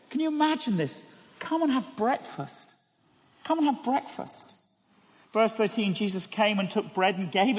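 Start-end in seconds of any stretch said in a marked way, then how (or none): 1.4-2.7 tender invitation. Acted. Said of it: "these wonderful words". 3.4-4.5 higher, softer repeat of the invitation; softer tender echo. Acted. Jesus again calling disciples to eat, tenderly. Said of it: "these wonderful words".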